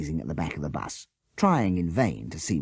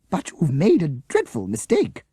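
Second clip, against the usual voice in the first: protesting; 10 semitones higher, 6 dB louder than usual. A narrator's reading. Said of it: "she protested".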